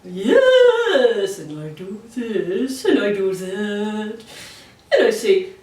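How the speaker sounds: sing-song voice going up and down; musical sing-song. Shown as a demonstration. Agent showing the musical puppet voice. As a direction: let the pitch dance up and down. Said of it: "sometimes you're very musical".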